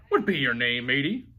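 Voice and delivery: a touch of a hearty pirate; pirate asking. A narrator's reading. Head pirate asks the boy's name, light pirate voice. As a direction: ask like a gruff but friendly captain. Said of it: "the head pirate asked".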